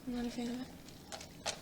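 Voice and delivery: hoarse, strained voice after coughing; strained throat. Real, not acted. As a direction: talk through a sore throat. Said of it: "hurt my throat".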